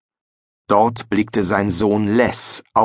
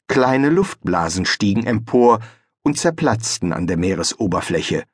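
plain reading voice

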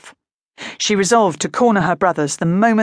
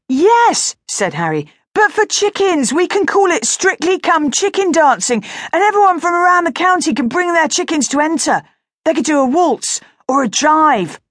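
much higher and louder; excited boy; 10 semitones higher, 4 dB louder than usual. Acted. Harry proposing a contest, high and loud. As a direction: burst out with the idea.

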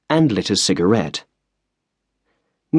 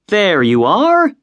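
high, slow, louder triumphant line; smiling, pleased; 10 semitones higher, 4 dB louder, slower than usual. A narrator's reading. Washington showing the others the clean floor, smiling and triumphant. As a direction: show off the clean floor with a smile. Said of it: "he said, smiling at the others".

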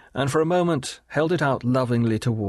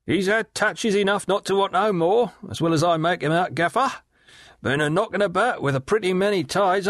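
high, sly leering call across the water; a higher, knowing river rat's voice; leering waterman; 7 semitones higher than usual. Acted. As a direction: Riderhood hailing Gaffer with a sly leer, pitched high. Riderhood's voice held.

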